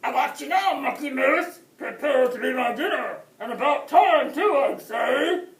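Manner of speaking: menacing shout. A narrator's reading. Wolf threatens Mucky.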